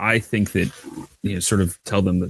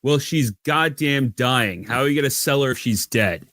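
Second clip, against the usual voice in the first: mildly acted urgency, higher voice; desperate argument; 6 semitones higher than usual. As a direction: argue urgently with a captor. Captive keeps arguing with guard.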